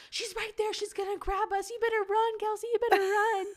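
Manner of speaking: high, loud mock-scary warning; teasing fake fright. Older sister's friends scaring Kelsey, high loud mock warning. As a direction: spook the little sister.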